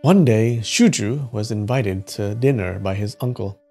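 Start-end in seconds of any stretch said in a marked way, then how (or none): none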